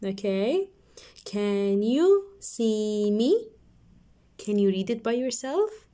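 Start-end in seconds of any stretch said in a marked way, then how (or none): 1.2-3.5 slow, stretched question; slow reading. Shown as a demonstration. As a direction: drag it out for kids. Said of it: "Let's read the sentences down below".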